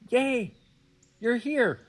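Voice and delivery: very high, loud squeal of welcome; playful, high-pitched hooray; delighted forest. Pretend. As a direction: cheer like an overjoyed forest greeting a friend. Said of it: "how the forest is like".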